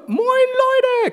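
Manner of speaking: drawn-out high singsong moin Leute; catchphrase greeting. Retold or imitated. Friend's catchphrase imitated as a slow high call. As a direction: stretch the greeting out slowly, way up high.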